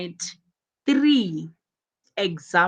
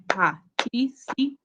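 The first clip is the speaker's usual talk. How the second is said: "participate" in four separate beats, par-ti-ci-pate; syllable by syllable; 5 semitones higher than usual. Shown as a demonstration. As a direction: clap out each syllable of "participate" with gaps between. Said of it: "you just clap your hands".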